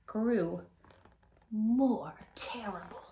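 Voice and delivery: slow, ominous whisper; slow spooky whisper. A narrator's reading. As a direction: breathe it out slowly for suspense.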